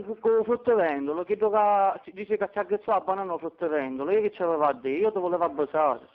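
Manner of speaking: ordinary talk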